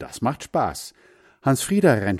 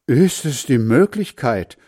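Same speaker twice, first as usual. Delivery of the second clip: louder, higher amazed father; amazed call; 5 semitones higher, 6 dB louder than usual. Acted. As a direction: call out in disbelief. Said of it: "ruft Vater".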